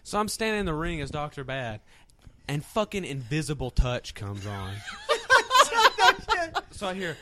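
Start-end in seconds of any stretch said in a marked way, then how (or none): 4.8-6.6 real laughter, high and loud; a high cackle at the joke; high burst of laughter. Real, not acted. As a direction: crack up at the music. Laughs at the song choice.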